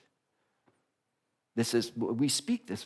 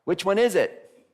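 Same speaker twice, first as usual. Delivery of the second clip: insistent, louder and higher voicing of the Unity side; 8 semitones higher, 7 dB louder than usual. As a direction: press the question as an impatient idealist. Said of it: "over here in Unity is going".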